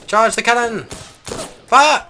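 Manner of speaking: high, loud mock order; battle command. Player orders troops to charge the cannon, shouted command. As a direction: shout it like a commander to the gun crew.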